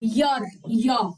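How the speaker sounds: ईयर said like English "year", with a clear y glide at the start; correct English pronunciation. Shown as a demonstration. Teacher to students, modelling ईयर as "year". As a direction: say ईयर as "year", deliberately and low. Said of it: "इसके pronunciation English में होते हैं ईयर".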